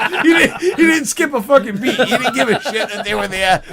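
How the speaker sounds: high, loud telling through laughter; laughing. Real, not acted. Telling how he ignored the cops, laughing hard. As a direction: barely get it out for laughing.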